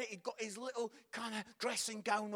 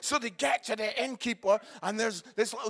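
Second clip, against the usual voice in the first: ordinary talk, nothing marked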